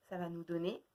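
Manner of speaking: usual speaking voice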